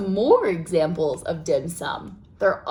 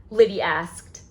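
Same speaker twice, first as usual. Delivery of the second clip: ordinary talk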